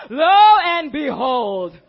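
slow, loud, wailing lo and behold; drawn-out exasperation. Retold or imitated. To the church, wailing over yet another steak dinner. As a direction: stretch it out like you can't believe it again.

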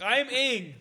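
high-pitched Airbender parody; mock movie hero. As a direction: declare it like a hammed-up cartoon hero. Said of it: "He's like".

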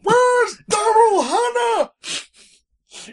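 slow, high mock yell; mock shout. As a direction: demand it like a cheated fan. Mocking old fans' complaints.

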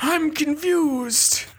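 high, slow baffled elder; old person voice. Pretend. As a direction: feign being a confused old man. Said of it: "as an old person".

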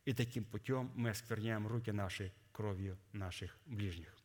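ordinary talk, nothing marked

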